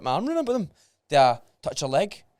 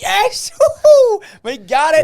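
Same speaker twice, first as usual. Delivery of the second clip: excited cheer; 14 dB louder, slower than usual. Retold or imitated. Celebrating remembered first kiss.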